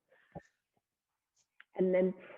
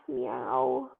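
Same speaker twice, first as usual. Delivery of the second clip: put-on a higher, playful kitty meow for the kids; cat meow; 4 semitones higher than usual. Shown as a demonstration. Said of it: "You can do a little moo here if you like".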